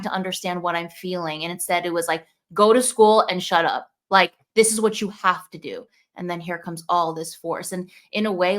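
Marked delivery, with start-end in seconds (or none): 2.5-5.9 louder, stern command; mock stern mom ordering her child; stern parental order. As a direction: bark it like a strict parent.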